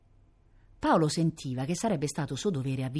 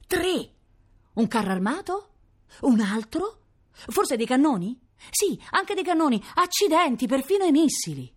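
higher, louder, rising to amazed exclamations; mounting excitement; 9 semitones higher, 3 dB louder than usual. A narrator's reading. Boy spotting tanks and missiles arriving, mounting excitement. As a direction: build from eager questions to astonished cries. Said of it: "si disse Paolo eccitassimo".

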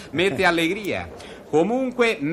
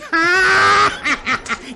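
loud forced laugh; 14 semitones higher, 6 dB louder than usual. Acted. Host laughs at own joke.

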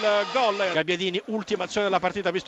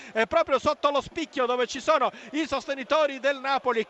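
normal talking voice

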